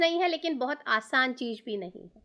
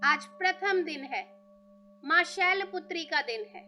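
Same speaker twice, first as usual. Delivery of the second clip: guided meditation. Real, not acted. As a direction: ease them into stillness, leaving room to breathe.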